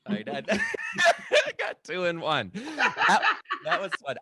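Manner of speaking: loud high laughter through words; delighted burst of laughter; laughing. Real, not acted. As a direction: crack up at your own pun. Laughing at own joke.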